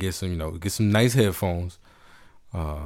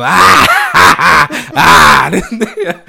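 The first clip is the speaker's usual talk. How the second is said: loud, high mock cackle; big hammed-up shrieking laugh; hysterical laughing; 8 semitones higher, 14 dB louder, much slower than usual. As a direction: imitate a wife's hysterical laughing fit. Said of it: "starts laughing hysterically".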